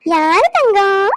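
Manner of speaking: slow, drawn-out coo with a rising end; baby talk. Acted. Grandmother slowly cooing to her baby grandson. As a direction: stretch out Thangam slowly with a lifting coo.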